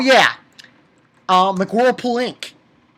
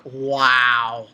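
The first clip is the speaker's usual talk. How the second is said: low, drawn-out wow; awed wow; 6 semitones lower than usual. Real, not acted. Reviewer stunned by the proposal, low awed wow. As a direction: sink into a low amazed wow.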